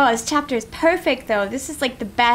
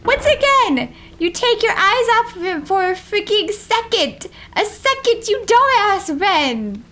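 raised, higher-pitched telling-off; exasperated scolding; 6 semitones higher, 4 dB louder than usual. Real, not acted. Player berating Ren for losing Lee, exasperated scolding.

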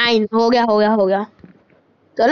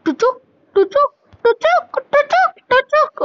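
tuchuk sound effect; 11 semitones higher than usual. Pretend. Playful sound effect while stabbing.

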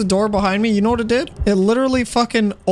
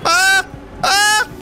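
two high startled yelps; short screams; 10 semitones higher than usual. Real, not acted. Player chased by a slow enemy, short high screams. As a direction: scream twice in quick panic. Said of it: "I don't know why I'm screaming".

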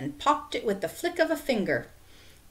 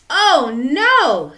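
alarmed, loud high cry; shout; 7 semitones higher, 11 dB louder than usual. A narrator's reading. Stephen hears the skateboard is lost, shouted in alarm. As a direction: shout it out in dismay. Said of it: "Stephen shouted".

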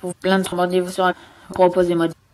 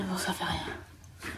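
whispered; 3 semitones lower, 18 dB quieter, much slower than usual. Real, not acted. Disappointed aside.

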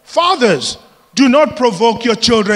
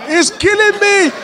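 mom's fake cry; 11 semitones higher than usual. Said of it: "she's lying now".

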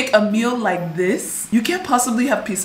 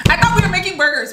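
exasperated; 8 semitones higher, faster than usual. Real, not acted. Repeating to the cook that they were making burgers, higher and exasperated. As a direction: insist on it in disbelief.

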